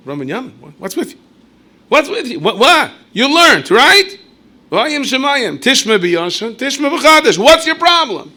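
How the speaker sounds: high, loud exasperated father; a father shouting at his stuck son; father scolding. Acted. Father voiced rebuking son.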